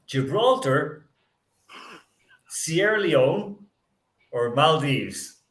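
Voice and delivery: each option drawn out slowly with a pause after; slow dramatic reading. Shown as a demonstration. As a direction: read the choices slowly, building suspense.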